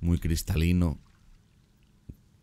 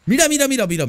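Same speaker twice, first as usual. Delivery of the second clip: much higher, louder excited calling; thrilled call; 6 dB louder, faster than usual. Real, not acted. Vlogger pointing out the moving apes, thrilled calling. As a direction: urge them to look, thrilled.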